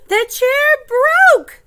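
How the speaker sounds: high, loud, drawn-out announcement; dramatic exclamation. A narrator's reading. Storyteller revealing the broken chair, dramatic exclamation. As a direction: cry it out slowly for the children.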